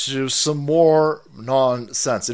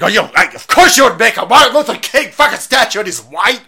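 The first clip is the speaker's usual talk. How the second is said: mocking critic; 10 semitones higher, 9 dB louder than usual. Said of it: "They're, they're mad at that one".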